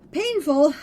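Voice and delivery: painful blurted out loud and high; emphatic painful. Real, not acted. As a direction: punch out painful with anguish. Dramatic complaint about demon writing.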